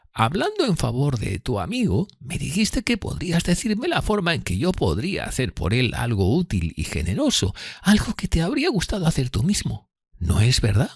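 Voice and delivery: high-pitched character voice, timid and earnest; fearful Miss Havisham, earnest. Acted. Miss Havisham confiding in Pip, high fearful voice. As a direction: voice her high and thin above the narration.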